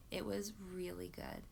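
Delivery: soft, near-whispered verdict; hushed sincerity. Real, not acted. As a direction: say it under your breath, sincerely. Hushed verdict on a moving book.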